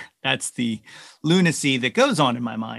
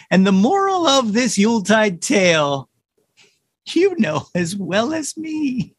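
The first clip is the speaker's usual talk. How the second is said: lively, lilting storybook poem reading; poem recitation; 7 semitones higher, 4 dB louder than usual. Acted. Performer reads a Christmas poem to listeners, lilting recitation. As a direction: recite it with big rhythmic swings in pitch.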